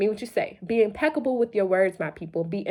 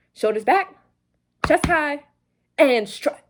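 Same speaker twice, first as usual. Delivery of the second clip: louder, drill-sergeant bark; Mulan quote; 6 semitones higher, 5 dB louder than usual. Pretend. Coach quoting Mulan as a loud mock command. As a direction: call it out like a marching order. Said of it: "That was from Mulan".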